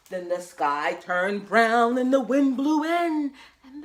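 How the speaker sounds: loud, slow, swelling narration; stormy storytelling. A narrator's reading. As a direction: let the wind swell in your voice.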